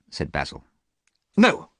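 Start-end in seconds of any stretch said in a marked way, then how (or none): 1.4-1.7 a sharp, loud, high "No"; shouting. A narrator's reading. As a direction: cut him off with a loud cry. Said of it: "shouted Dorian".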